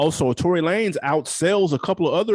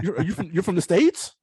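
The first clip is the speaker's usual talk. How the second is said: mock London accent, higher and surprised; imitated Londoner; 5 semitones higher than usual. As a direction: imitate a curious Londoner's question. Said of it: "as soon as you start talking".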